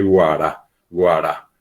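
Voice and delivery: guara with a plain oral g, no nasality. Shown as a demonstration. Teacher showing what we don't say, guara without nasality. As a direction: say guara with hard g and tapped r. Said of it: "no decimos se sipe".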